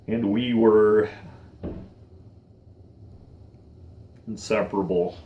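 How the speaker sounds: words fading as emotion takes over; emotional. Real, not acted. Remembering an inseparable friend, quietly emotional. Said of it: "I'm getting emotional today".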